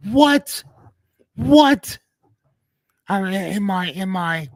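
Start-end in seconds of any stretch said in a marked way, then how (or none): 0.1-2.0 high, loud hype-man shout of what; a first hammed-up shot at the hype-man yell. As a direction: bark the word out like a crunk hype man. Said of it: "in my Little John voice".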